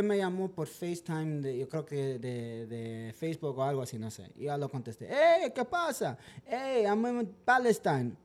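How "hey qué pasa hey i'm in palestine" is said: dueño del perro. Retold or imitated. Imita al dueño llamando.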